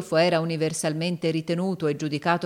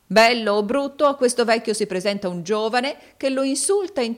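loud, raised, insistent character voice; goading moneylender; 7 semitones higher, 6 dB louder than usual. A narrator's reading. Rodolfo needling the old suitor about his rival, forceful taunting. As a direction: press the point hard, pitched up.

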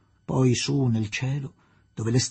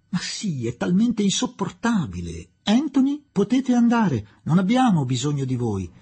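impatient, higher-pitched dismissal; 7 semitones higher, 3 dB louder than usual. A narrator's reading. Adela dismissing the servant Anthony, impatient. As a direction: send the servant away with impatience. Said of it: "disse con impazienza Adela".

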